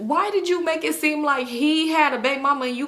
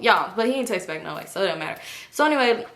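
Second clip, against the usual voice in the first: normal talking voice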